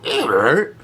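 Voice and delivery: vocal imitation of a needle scratch; record scratch noise. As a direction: mimic a record screeching to a halt. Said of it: "you hear the record player go".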